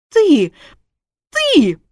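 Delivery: loud, high, accusing shout; shocked outcry. Acted. Wife wakes to find husband watching, shocked shout. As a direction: burst out twice, rising in outrage.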